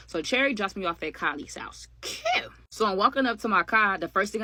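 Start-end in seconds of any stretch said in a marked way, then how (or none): 2.0-2.5 high sing-song sarcastic "Cute". Acted. Telling the story, sarcastically high "Cute". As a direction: toss off "Cute" in a high mocking lilt.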